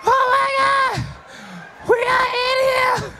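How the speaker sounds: high, slow mock gasp of a friend; shocked friend. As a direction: squeal it in slow disbelief. Voicing a shocked friend on phone.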